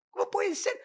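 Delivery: sudden high voiced disbelief; mock incredulity. Preacher voices a doubting listener, mock shocked and high.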